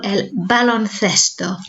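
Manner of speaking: baloncesto said slowly with a th in cesto; slow Spanish el baloncesto; soft th baloncesto. Shown as a demonstration. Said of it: "remember our soft th sound".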